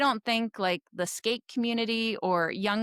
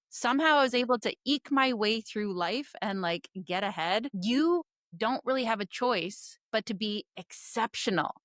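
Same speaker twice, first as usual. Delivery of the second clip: ordinary talk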